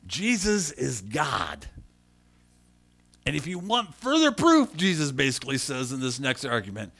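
loud, high, fervent preaching; full-force preaching. Acted. Pastor acting out Jesus and Philip for the church, loud. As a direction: preach the scripture with fire. Preaching the John fourteen dialogue.

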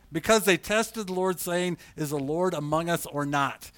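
preacher reading Exodus aloud; scripture reading. Acted. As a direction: read it out to the congregation.

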